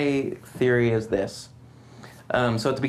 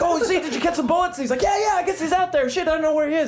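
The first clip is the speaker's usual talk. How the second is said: high silly voice; 13 semitones higher, faster than usual. Retold or imitated.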